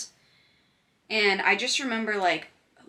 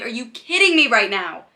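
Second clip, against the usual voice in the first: loud, high are-you-kidding-me outrage; exasperated disbelief; 4 semitones higher, 11 dB louder than usual. Retold or imitated. Girl stuck at home as covid hits, loud and exasperated. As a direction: throw up your hands at the bad luck. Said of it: "And I'm like".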